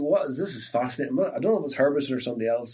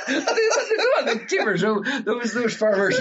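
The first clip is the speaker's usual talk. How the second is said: laughing high through the words; giggling so hard the words squeak up; 10 semitones higher, 4 dB louder than usual. Real, not acted. Laughing at the memory.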